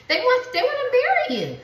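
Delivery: sudden high, thrilled burst; excited. Real, not acted. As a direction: blurt it out with delight. Reading reveals proposal energy.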